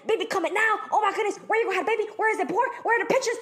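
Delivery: high, fast, frantic gushing; mock hyperventilating. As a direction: reenact going crazy with excitement.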